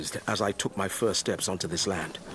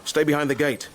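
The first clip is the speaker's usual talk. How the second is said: called out louder and higher, commanding; urgent warning; 6 semitones higher, 5 dB louder than usual. Acted. Tending the birth, warning his friend off, loud urgent call. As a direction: order him to keep back, sharply.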